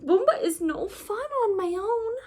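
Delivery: mildly tearful small voice; sniffled. A narrator's reading. Flop after falling down alone, a touch of tears. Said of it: "sniffled Flop".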